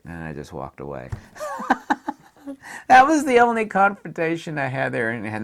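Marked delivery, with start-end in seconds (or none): 1.1-2.1 squealing giggle; high laugh. Real, not acted. Ending his confrontation story, high giggle.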